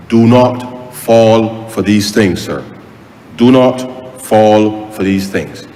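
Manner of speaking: slow, deliberate warning, each word spaced; slow solemn warning. Real, not acted. MP warning the public not to fall for scams, slow and deliberate. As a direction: drag every word to make it land.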